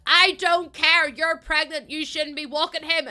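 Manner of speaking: loud, high angry-mum telling-off; mad mum. Retold or imitated. Mum furious at pregnant daughter for dog walking, mock angry telling-off. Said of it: "she was so mad at me".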